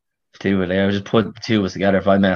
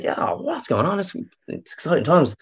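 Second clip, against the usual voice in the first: mock excited chatter; 9 semitones higher, 3 dB quieter than usual. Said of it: "It's like".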